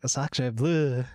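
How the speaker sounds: a lightly put-on French accent; bad French accent. A viewer asks for a French impression, so a lightly put-on French accent. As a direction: toss off a cheesy French accent. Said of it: "best French impression".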